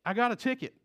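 normal talking voice

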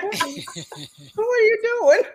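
high, loud laughing disbelief; surprised. Retold or imitated. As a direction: squeal it out in amused shock. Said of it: "I'm like".

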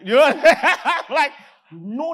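loud burst of laughter; laughing. Real, not acted. Preacher laughing to congregation about no negotiation. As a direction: laugh it off loudly. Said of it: "laughing about it".

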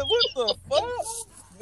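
a stunned high "what the fuck"; shocked outburst. Retold or imitated. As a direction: pitch shoots way up, drawn out slowly. Retelling his outrage at the club.

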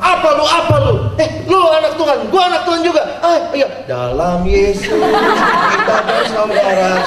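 high, loud mock quarrel melting into a laugh; mock brawling hero. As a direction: ham up a cheesy soap fight. Mock sinetron quarrel then reconciliation.

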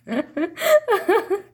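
high, loud burst of laughter; helpless loud laugh. Real, not acted. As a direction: crack up at your own story. Laughs at own bird-feeding story.

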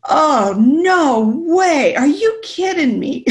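loud, high, drawn-out disbelief; hammed-up incredulous wail; freaking out. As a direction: re-enact a freak-out, stretching the words. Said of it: "But I am freaking out".

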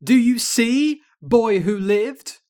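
high, loud taunt, drawn out; hammed-up wicked witch jeer; cackling evil witch. A narrator's reading. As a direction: gloat over the beaten hero.

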